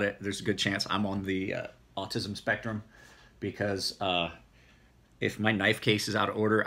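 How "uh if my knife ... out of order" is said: usual speaking voice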